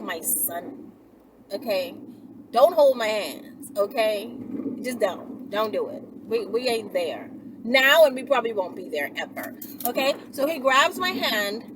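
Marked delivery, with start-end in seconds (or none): none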